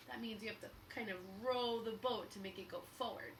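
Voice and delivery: ordinary delivery, nothing marked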